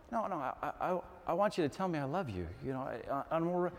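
lightly acted wife faltering; wife's hesitant plea. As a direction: tentatively try to explain. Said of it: "this is your wife, husbands, to you".

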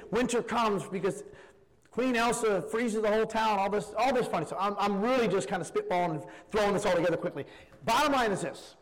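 normal talking voice

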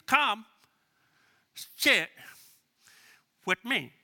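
each piece set apart by long pauses; deliberate invitation. Shown as a demonstration. Volunteer inviting a friend to church, spaced out and deliberate. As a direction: land every word separately.

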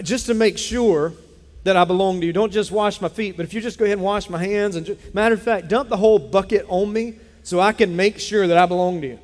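preaching at full force; fervent preaching. Acted. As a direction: build the plea up like a pulpit cry. Preaching Peter's plea at force.

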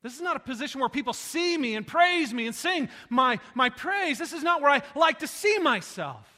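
loud, rising preacher's cry; preaching at full force. Acted. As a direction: preach it out to the whole room. Preaching build.

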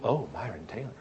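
very soft, low murmur of recognition; awed little undertone; hushed recognition. Acted. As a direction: murmur it low, as if impressed by the name. People reacting to the name dropped.

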